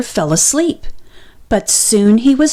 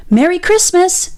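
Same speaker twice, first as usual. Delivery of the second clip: bright, high holiday greeting; cheery shout; 9 semitones higher than usual. A narrator's reading. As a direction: call it out gladly. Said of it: "shouted Squirrel".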